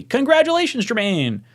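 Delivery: bright excited congratulations; cheerful congrats. Real, not acted. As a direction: call out congratulations. Congratulating a player.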